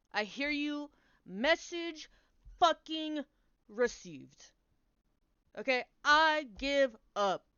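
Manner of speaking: loud and high, talking to the universe; retold fed-up rant at the universe; exasperated surrender. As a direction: give up with sarcastic fury. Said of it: "when I was like".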